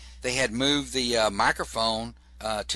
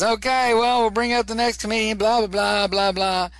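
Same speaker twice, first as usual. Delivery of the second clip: louder, higher comic emcee straining to be heard; imitating a struggling host, high and loud; talking over the crowd; 9 semitones higher, 5 dB louder than usual. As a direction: push it up high over a noisy room. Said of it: "he tries to talk louder than the audience".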